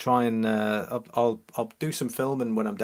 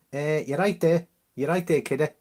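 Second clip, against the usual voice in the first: higher-pitched Liverpudlian greeting; Scouse accent; 5 semitones higher than usual. Shown as a demonstration. Streamer showing how Liverpudlians sound, Scouse accent. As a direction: put on a playful Scouse voice, pitched up. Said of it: "that's how the, uh, the Li-, uh, the Liverpudlians sound".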